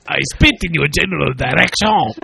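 hammed-up French insult; mock French accent. Co-host trading silly insults, mock French accent.

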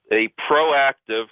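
"proactive" drawn out slowly for emphasis; stress on PROACTIVE. Real, not acted. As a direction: lean slowly on the word "proactive". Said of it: "I emphasize the word proactive".

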